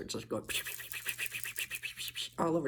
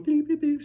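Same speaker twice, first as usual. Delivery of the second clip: bleeps; 11 semitones higher, 6 dB louder than usual. Retold or imitated. Imitating the light beeping.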